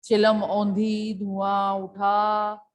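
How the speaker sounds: slow, deliberate poem reading; slow poem recital. Shown as a demonstration. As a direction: read each word slowly like verse. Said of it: "teacher reads the poem line".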